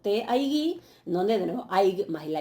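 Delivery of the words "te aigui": aigui stressed on its last syllable, GUI; higher pitch, landing the stress on the end of aigui; acento final. Shown as a demonstration. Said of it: "con el acento, eh, en la última sílaba". (aigui con acento en la última sílaba, GUI)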